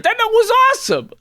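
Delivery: very high, squeaky put-on whine; hammed-up high-pitched self-defence; mock boyfriend. Mocks the boyfriend's clueless reply.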